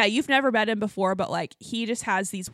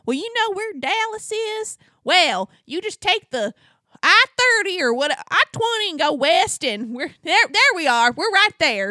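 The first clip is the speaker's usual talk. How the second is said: high Texan giving directions; pitched-up Texas twang; thick Texas accent; 9 semitones higher, 5 dB louder than usual. Retold or imitated. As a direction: imitate a boisterous Texan explaining the route. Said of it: "he has the thickest Texas accent".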